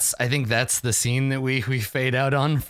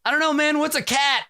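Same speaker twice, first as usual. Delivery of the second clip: much higher, clueless goofy voice; dim Slag voice; 13 semitones higher than usual. Acted. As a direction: play a baffled dimwit. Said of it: "Slag's like".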